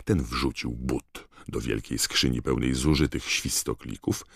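usual speaking voice

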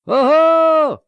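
very high, loud whoop; full-voiced herald's shout; ceremonial call. A narrator's reading. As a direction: cry out to summon the crowd's attention. Chamberlain strikes staff, calls out.

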